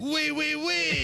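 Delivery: high, hammed-up French "oui"; mock French noises. Host clowning after a failed accent reading, high mock French. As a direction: exclaim it like a cartoon Frenchman. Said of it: "you can make the noises the French, French would make".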